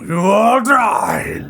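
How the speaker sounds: slow, loud booming roar; booming monster voice. Acted. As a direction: bellow the threat like a beast. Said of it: "booming voice".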